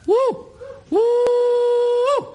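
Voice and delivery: high falsetto hooting woo calls; loud high-pitched call into the night; bunyip mating call. Shown as a demonstration. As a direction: hoot it like an animal calling in the bush. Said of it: "You, you call again".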